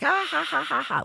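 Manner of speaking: high-pitched mock maniacal "ha ha ha"; imitated crazy laugh. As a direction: cackle like crazy, high and put-on. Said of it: "laughing like crazy".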